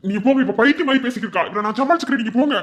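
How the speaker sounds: hurried urging. Acted. Husband hurries guest away.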